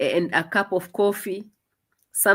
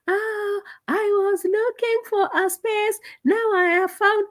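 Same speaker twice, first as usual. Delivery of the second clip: high-pitched mock of a video confession; mocking fake videos; slower than usual. Speaker mimics woman's fake purpose-finding videos, high mocking voice. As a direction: put on a high, drawn-out mocking voice. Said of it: "coming up with these fake videos".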